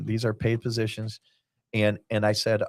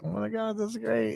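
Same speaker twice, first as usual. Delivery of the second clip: high, quiet, giddy gush; thrilled; 11 semitones higher, 7 dB quieter than usual. Retold or imitated. Host retelling his own delight at Chris winning, high and giddy. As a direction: gush with delight under your breath. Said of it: "I'm like".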